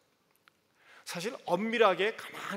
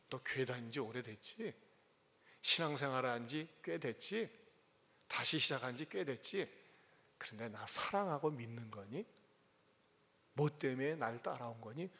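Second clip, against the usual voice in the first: gentle asking; 6 semitones lower, 8 dB quieter than usual. Retold or imitated. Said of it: "물어보고 계세요".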